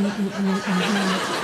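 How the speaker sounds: normal talking voice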